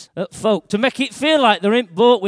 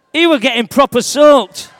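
louder, over the storm; raised voice; 6 semitones higher, 8 dB louder, slower than usual. Acted. Peter shouting over the storm to the kids. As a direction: call it out above the water spray. Storm scene, water sprayed.

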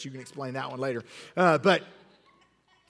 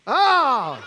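surprised oh; 7 semitones higher, 8 dB louder than usual. Real, not acted. Reacting to the stabbing act.

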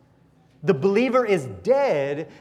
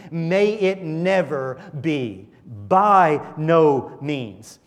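slow, every word weighted, "By no means." punched louder; emphatic denial; 3 dB louder, much slower than usual. Shown as a demonstration. Preacher echoing Paul's denial, slow and emphatic. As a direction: plead "May it never be" with full force. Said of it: "Paul could not be any more emphatic in his denial".

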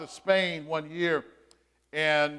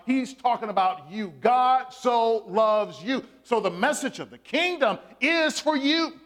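raised, emphatic sermon delivery with long pauses; preaching; 5 semitones higher, 4 dB louder than usual. Acted. Pastor preaching to his congregation, emphatic and raised. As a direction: call out to the congregation, drawing them in.

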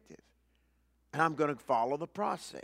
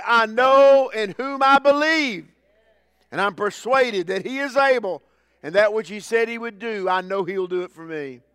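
declared confession of faith; 5 semitones higher, 10 dB louder than usual. Retold or imitated. Preacher voicing the believer's bold confession, loud and soaring. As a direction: proclaim it like a triumphant vow. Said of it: "and say".

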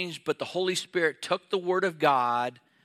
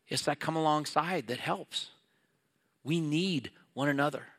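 ordinary talk, nothing marked

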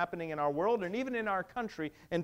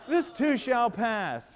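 slow, louder sing-song sneer at the cliché, laughing; mocking the comforting cliché, slowly and louder; mocking platitude; 4 semitones higher, 5 dB louder, much slower than usual. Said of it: "I hate that".

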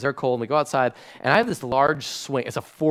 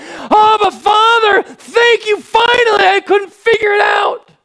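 high, loud cry of relief; far-off, exultant yell; joyful shout; 15 dB louder, slower than usual. Acted. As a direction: shout it out to the Father like a child who finally gets it. Child finally crying out to Father.

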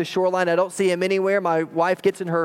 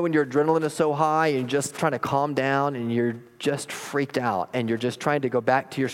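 usual speaking voice

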